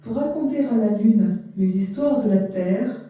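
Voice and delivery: measured poetry reading, spaced line by line; poem recitation. Acted. Translator reading short poems to listeners, poem recitation. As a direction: recite it with deliberate pauses between phrases.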